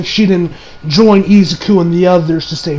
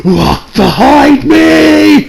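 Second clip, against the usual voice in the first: slow, drawn-out shout, a step higher; barked order, dragged out with swagger; Bakugo-style bark; 6 semitones higher, 4 dB louder, much slower than usual. Retold or imitated. Bakugo ordering classmates to walk behind him, slow shout. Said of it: "like, this aura".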